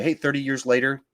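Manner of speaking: ordinary talk, nothing marked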